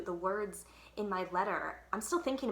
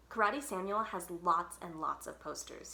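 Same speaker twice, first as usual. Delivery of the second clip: plain reading voice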